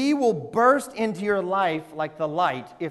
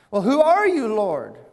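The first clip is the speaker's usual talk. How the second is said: frightened plea, pitched up then dropping on Lord; mock frightened; 5 dB louder than usual. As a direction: cry it out to heaven as if spooked. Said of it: "frightened by the whole thing".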